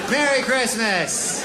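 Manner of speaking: slow mock cheer; slow mock-festive giver. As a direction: stretch out the holiday wish like a smug gift giver. Gift giver voiced in bit.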